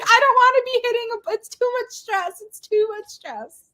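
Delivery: high, laughing whine; half-laughing complaint; laughing mock distress. Retelling batting anxiety, laughing.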